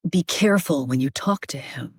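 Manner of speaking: low, measured dragon warning; deep dragon voice. Shown as a demonstration. Actor giving interviewer a taste of her dragon, deep regal voice. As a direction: drop your voice into a regal dragon's register. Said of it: "in the deeper register".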